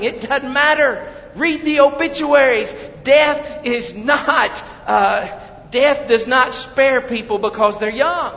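high, loud urgent exhortation; full-force preaching. Real, not acted. Preacher tells procrastinators to stop delaying, full-force preaching. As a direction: hammer it home to the congregation.

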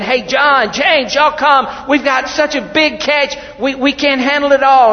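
loud high call across the water, slowed on "James, y'all come"; retold yell. Peter calling James and John to shore, loud yell. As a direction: holler to the shore for help with the nets. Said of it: "he yells out to the land".

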